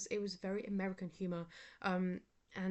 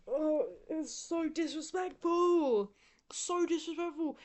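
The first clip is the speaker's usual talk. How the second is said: outraged; 8 semitones higher, 6 dB louder, slower than usual. Real, not acted. Ranting about phones at concert.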